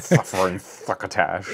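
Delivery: a hint of the lisping cartoon cat; Looney Tunes cat voice. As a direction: lightly lisp it like the old cartoon. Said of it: "those old Looney Tunes".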